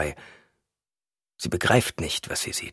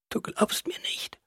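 slow, soft whisper; weak whisper; 8 dB quieter, slower than usual. A narrator's reading. Wife feels disbelieved by husband, slow weak whisper. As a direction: whisper it slowly, with little strength.

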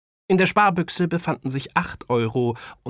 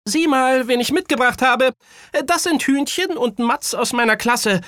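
put-on high, loud child character; proudly introducing boy; 6 dB louder than usual. A narrator's reading. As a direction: present your classmates in a kid's high voice. Voices Kaya introducing classmates. (Junge stellt laut und hell seine Freunde vor)

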